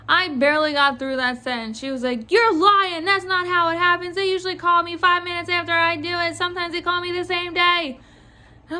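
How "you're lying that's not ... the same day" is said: higher-pitched irate customer impression; imitated freak-out. Clerk mimics customer accusing her of lying, freaking out. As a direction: burst out accusing, then rush on in mimicry. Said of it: "freaked out on me".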